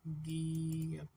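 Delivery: गीयक very slow, first "gii" stretched out; letter-name breakdown. Shown as a demonstration. Teacher points out the starting sound, slow drawn-out गीयक. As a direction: draw out गीयक softly to expose its opening sound. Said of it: "पहली sound थी starting वो जी थी".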